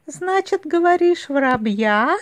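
high, questioning fox voice; mocking fox. A narrator's reading. Fox repeats bear's answer back, high and teasing. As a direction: tease the bear with a high query.

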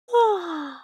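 big falling yawny sigh; open, sighing bedtime yawn; acted yawn. A narrator's reading. Acted yawn before "I yawned again.

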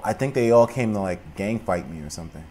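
normal talking voice

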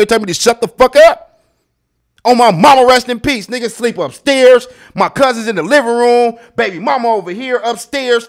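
high, loud bragging shout; loud, high-pitched bragging; loud boast. Real, not acted. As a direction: yell it like you own the whole house. Boasting loudly about his house.

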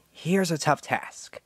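ordinary talk

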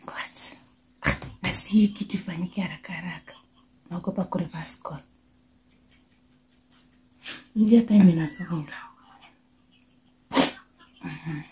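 hushed whisper. Real, not acted. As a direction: whisper it so nobody hears. Hushed talk during phone prank.

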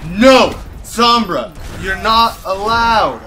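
loud, emphatic telling-off; loud protest, last words drawn out; indignant shout. Real, not acted. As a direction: shout the opponent down. Scolding an enemy player.

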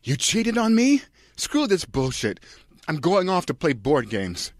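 hammed-up jilted husband storming off; Alexander Skarsgård. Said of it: "Alexander Skarsgård".

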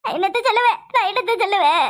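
wailing lament; theatrical tearful wail; crying. Acted. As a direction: wail it helplessly, as if in tears. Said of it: "இப்ப எதுக்கு மட்டும் இப்படி அழுவுற?".

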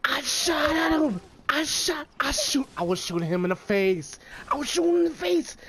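high, loud protest; raging gamer. Real, not acted. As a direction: complain in outrage. Raging defence after dying.

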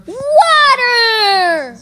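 loud joyful cry of relief; loud thrilled cry; excited shout. Pretend. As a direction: call out the find in delight. Finds water, cries out.

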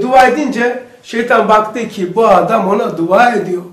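normal talking voice

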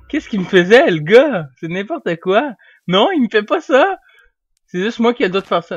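loud, high worked-up rant; agitated rant. Real, not acted. Gamer ranting about the player who attacked him, worked-up rant.

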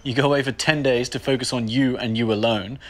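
ordinary talk, nothing marked

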